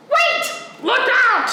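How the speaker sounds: shouting warning. Acted. Child warning as the porch gives way, loud.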